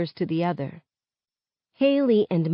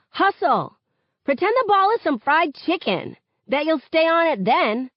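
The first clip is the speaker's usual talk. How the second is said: shouting; 10 semitones higher than usual. A narrator's reading. Teammate shouting on court.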